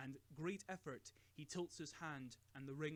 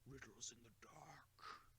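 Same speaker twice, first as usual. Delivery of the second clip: slow, soft whispered murmur; muttered; 2 semitones lower, 11 dB quieter, slower than usual. A narrator's reading. Gandalf alone in the study, brooding, muttered whisper. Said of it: "Gandalf mutters".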